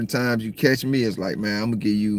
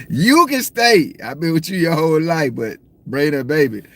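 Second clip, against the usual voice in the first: mock grandfather shout; 6 semitones higher, 4 dB louder than usual. Grandpa telling daughter to bring the baby, mock yell. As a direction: stretch out the grandpa's demand.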